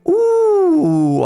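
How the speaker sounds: loud high yelp of pain. Real, not acted. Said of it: "I have hit my funny bone".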